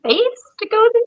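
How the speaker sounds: mock nervous phone call; nervous question. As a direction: plead anxiously for reassurance. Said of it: "on the phone to Hyundai being like".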